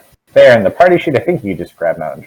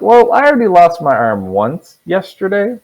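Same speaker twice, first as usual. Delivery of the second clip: higher, slow, drawn-out protest; lightly acted reluctance in a higher pitch; hesitant character voice; 10 semitones higher, 4 dB louder than usual. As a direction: voice a wary game character, slowly. NPC voiced, reluctant over arm.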